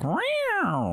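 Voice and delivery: high, far-off imitated meow; cat meow. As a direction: mew like the cat on the record. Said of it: "that kinda sounded like a cat".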